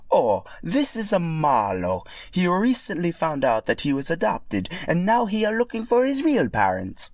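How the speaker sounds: put-on high, bright Italian-accented Mario. Narrator voicing Mario for Yoshi, Italian accent. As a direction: play Mario with a cheery Italian lilt.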